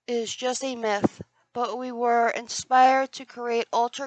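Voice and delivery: slow, dramatic ad voiceover; ad read. Acted. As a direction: read it like a grand commercial. Reads the can text aloud.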